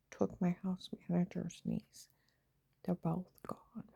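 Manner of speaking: ordinary delivery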